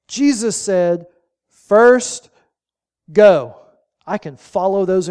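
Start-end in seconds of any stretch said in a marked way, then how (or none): 1.5-3.7 loud, two punched words with a pause between; emphatic command. Retold or imitated. Preacher quoting Jesus' simple instruction to the congregation, emphatic command. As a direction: deliver each word as a big, simple order.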